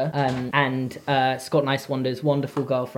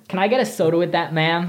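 put-on British speaker doing an American accent. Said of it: "just a guy doing an accent".

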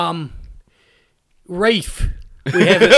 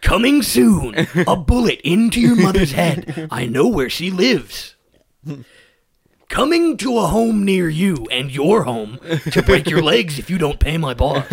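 movie-trailer henchman. Acted. Henchman threatening a debtor for his boss, trailer voice. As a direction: threaten like a coming-attractions announcer. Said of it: "henchman who talks like it's a movie trailer".

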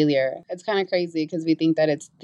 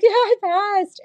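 high-pitched mock happy crying; tearful joy; slower than usual. Daughter telling mom she passed, high tearful joy. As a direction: imitate blurting good news through happy tears. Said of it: "I start crying".

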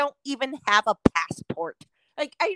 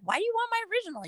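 exasperated; 11 semitones higher, 6 dB quieter, much faster than usual. Retold or imitated. Exasperated retold complaint.

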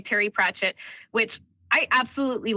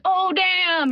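high, drawn-out "oh damn"; imitated crowd reaction; 9 semitones higher than usual. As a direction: mimic a whole bar reacting at once.

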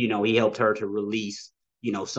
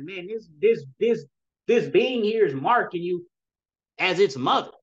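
high-pitched, stammering wonder; retold amazement; 7 semitones higher, 4 dB louder than usual. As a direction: recount your astonished announcement in a raised pitch. Retelling amazed words to his wife.

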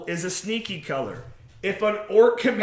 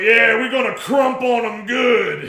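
cocky space marine; 5 semitones higher, 7 dB louder than usual. Retold or imitated. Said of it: "he's like".

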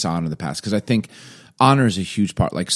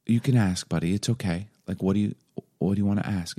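reassuring; 6 dB quieter than usual. Retold or imitated. Reassuring crying son.

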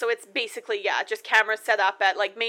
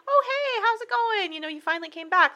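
very high, sing-out hello easing downward; mock casual greeting; 12 semitones higher than usual. Girl to the oddly standing guy, mock casual greeting. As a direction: imitate an over-light, high-pitched greeting. Acting out girl's uneasy greeting.

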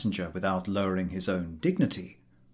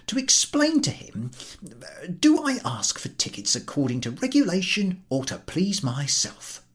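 higher, aggrieved official voice; fussy ticket collector; 10 semitones higher than usual. Acted. Ticket collector complaining to the station master, aggrieved. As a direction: plead his case to the station master.

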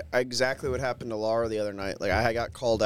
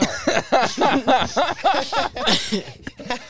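real burst of high delighted laughter; helpless giggling at the song; 12 semitones higher, 3 dB louder than usual. Real, not acted. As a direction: laugh out loud in amazement. Hearing their song played back.

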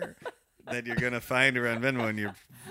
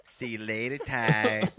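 cocky; slower than usual. Retold or imitated. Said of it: "like, cocky".

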